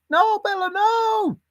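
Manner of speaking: very high No, Bella, no; mock high-pitched telling-off; high scolding sing-song. Shown as a demonstration. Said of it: "Is that what you say?".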